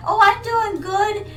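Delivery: lightly imitated old lady, words stretched. Old lady answering her in the salon, imitated old-lady voice. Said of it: "And she's like".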